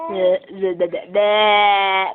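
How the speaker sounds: low, slow blehs; silly bleh noises. Pretend. A mother making silly low bleh noises at her baby. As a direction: tease the baby with tongue noises.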